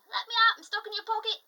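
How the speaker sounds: high, loud tiny leprechaun voice. Retold or imitated. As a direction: squeak like a trapped leprechaun. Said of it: "little leprechaun voice saying".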